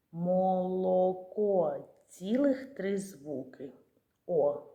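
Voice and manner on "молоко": "Молоко" drawn out slowly; slow sounding out. Shown as a demonstration. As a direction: stretch "Молоко" gently. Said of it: "Є". (повільно протягнути)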